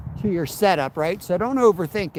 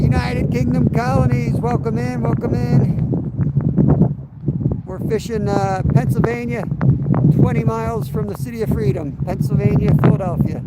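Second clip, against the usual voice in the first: loud raised voice, higher pitch; shouting over wind; 5 semitones higher, 4 dB louder than usual. Real, not acted. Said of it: "I'm gonna shout over the wind".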